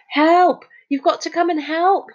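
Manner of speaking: high, urgent call for help; urgent appeal. A narrator's reading. Boy bursting in on the other children, urgent appeal. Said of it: "He'd never spoken like that".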